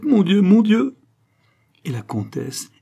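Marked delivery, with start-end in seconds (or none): 0.0-1.0 high, loud cry of distress; distraught outcry. Acted. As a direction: cry out in horrified despair. Distraught countess reads forged letter.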